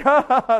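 loud, put-on "ha ha ha"; dismissive mock laugh. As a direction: fake a laugh to brush it off. Mimics church brushing off gossip.

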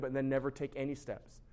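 ordinary delivery

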